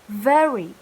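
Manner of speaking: Very with a buzzing V; bold V. Shown as a demonstration. Teacher modelling Very again. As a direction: press the V in Very. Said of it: "Once again".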